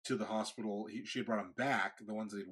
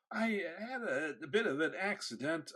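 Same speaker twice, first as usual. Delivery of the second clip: sheepish confession; 6 semitones higher than usual. Retold or imitated. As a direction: laugh it off guiltily.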